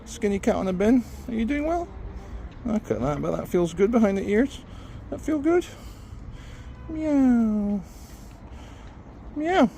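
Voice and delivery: squeaky pet talk with meows. Real, not acted. Baby-talking a street cat.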